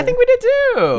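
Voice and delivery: high squeaky joke voice. Pretend. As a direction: squeak it out like a cartoon.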